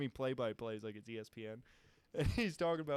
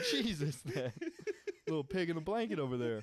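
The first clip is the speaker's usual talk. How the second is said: laughing; 6 semitones higher, 6 dB louder than usual. Real, not acted. Laughing at hidden dog.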